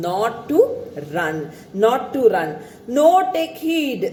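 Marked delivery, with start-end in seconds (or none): 3.0-4.0 conscience warning. Acted. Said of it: "take care".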